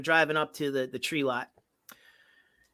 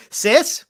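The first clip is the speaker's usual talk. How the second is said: "Sis." punched, higher and louder; exclamation point; 7 semitones higher, 8 dB louder than usual. Shown as a demonstration. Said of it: "With an exclamation point".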